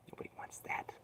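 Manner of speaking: soft whispered echo. Acted. Streamer echoing himself to chat in a whisper. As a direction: breathe the words out.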